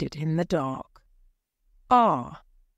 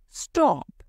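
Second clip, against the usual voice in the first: urgent cry; 8 semitones higher than usual. A narrator's reading. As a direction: halt her at once. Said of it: "he cried".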